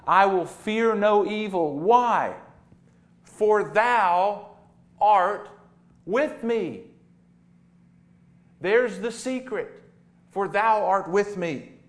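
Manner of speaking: rising sermon climax; preaching at full force. Acted. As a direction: proclaim the verse to the whole church.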